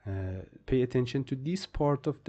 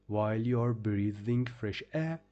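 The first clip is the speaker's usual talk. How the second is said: normal talking voice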